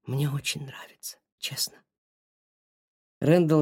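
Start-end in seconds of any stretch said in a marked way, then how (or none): 0.0-1.8 soft whisper. A narrator's reading. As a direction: whisper it through tears. Tearful confession after a whisper.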